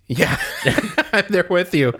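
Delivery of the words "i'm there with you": laughing. Real, not acted. Laughing agreement.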